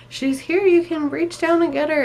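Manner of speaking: high, loud joyful call; excited announcement. Retold or imitated. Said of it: "they're like".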